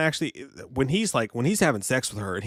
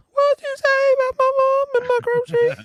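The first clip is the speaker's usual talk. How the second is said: silly high voice; 6 dB louder than usual. Acted. Jokey put-on voice outburst.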